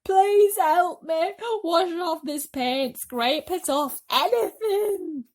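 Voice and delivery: high, loud, slow begging; desperate bawling. Acted. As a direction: bawl it, begging for help. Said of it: "bawled the centipede".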